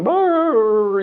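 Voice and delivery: high whining dog growl, mimicked; hound dog whine. Storyteller mimics the lazy hound dog, high whining growl. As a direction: imitate the hound dog's whimpering groan. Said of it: "big old nasty hound dog going".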